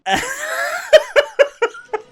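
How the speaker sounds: high, loud cackling laughter; real laughing fit. Real, not acted. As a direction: burst out laughing at your own confession. Cracks up at his own confession.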